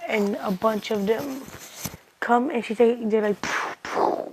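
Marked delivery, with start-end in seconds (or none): 3.4-4.3 puffed-out breathy poofs, no voice; poof sound effect. Retold or imitated. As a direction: imitate clones popping in with two airy puffs. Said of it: "making sound effects".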